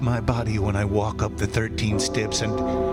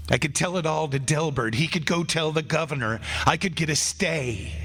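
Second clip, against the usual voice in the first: rising hope; 2 semitones higher than usual. Acted. Condemned man grasps at hope.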